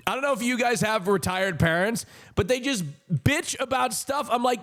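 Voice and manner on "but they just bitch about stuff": ordinary talk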